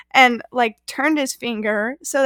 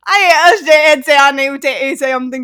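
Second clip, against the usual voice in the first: laughing gibberish; 8 dB louder than usual. Pretend. Laughing mock Pig Latin.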